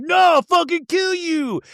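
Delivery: high, slow, strained threat; mock drunken threat. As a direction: snarl it like a drunk held back by his brothers. Drunk brother being held back.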